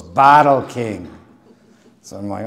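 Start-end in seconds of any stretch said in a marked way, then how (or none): 0.0-1.1 put-on Irish accent. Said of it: "He goes".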